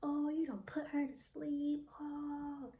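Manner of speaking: high, loud jeering hoots; mocking friends. Friends laughing at her on the ground, imitated high mocking jeers. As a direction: imitate friends hooting in mockery. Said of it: "his friends just laughing at me like".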